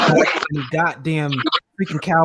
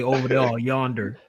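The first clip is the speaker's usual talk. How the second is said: Southern drawl; 4 semitones lower, 6 dB quieter than usual. Retold or imitated. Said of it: "waiting for somebody to say".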